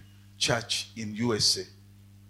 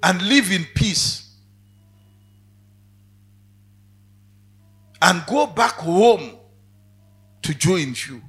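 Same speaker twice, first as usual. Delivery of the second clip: closing prayer; 3 semitones lower, 7 dB louder than usual. Acted. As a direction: pray for the congregation, building. Preacher leads a prayer.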